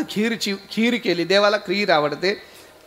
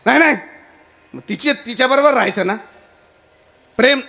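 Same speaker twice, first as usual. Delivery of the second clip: high, raised protest; acted marital quarrel, voice pitched up; jealous wife's retort; 5 semitones higher, 4 dB louder, faster than usual. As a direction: snap back at your husband in a jealous huff. Jealous wife quarrels with husband.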